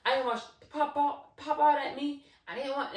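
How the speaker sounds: high, loud, stuttering protest; spooked, agitated insistence; animated cousin. Retold or imitated. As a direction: imitate him frantically explaining, full energy. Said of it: "he's real animated. So this is really him".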